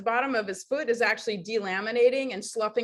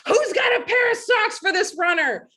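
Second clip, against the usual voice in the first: loud, high shout across a crowd; calling out; 10 semitones higher, 6 dB louder than usual. Retold or imitated. Volunteer asking everyone nearby to help a runner, calling out. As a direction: holler it out to everyone around.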